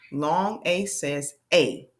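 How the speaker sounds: slow, drawn-out A; teacher modelling long A; long A sound. Shown as a demonstration. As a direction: say the letter name A, slowly. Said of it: "vowel demo".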